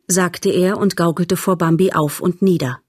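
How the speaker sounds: ordinary delivery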